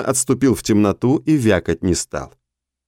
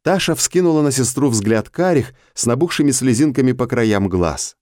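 ordinary delivery, nothing marked

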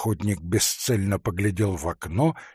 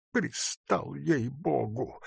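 soft, slow, higher complaint; peevish grumble; 3 semitones higher, 7 dB quieter, slower than usual. A narrator's reading. Forester is pestered by the hunter, grumbling softly. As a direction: grumble it wearily under pressure. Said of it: "поморщился лесник".

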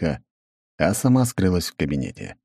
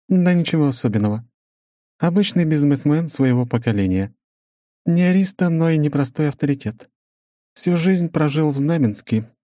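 measured, higher female voice; woman's voice; 9 semitones higher than usual. Acted. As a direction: narrator voicing a businesswoman, higher. Svetlana voiced.

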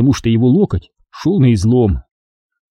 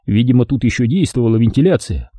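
ordinary delivery

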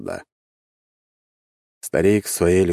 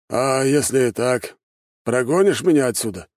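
put-on frowning old man; 7 semitones higher than usual. A narrator's reading. Old shopkeeper asks whether he'll be thrown out, wary. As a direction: test him warily in a high old voice. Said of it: "нахмурился старик".

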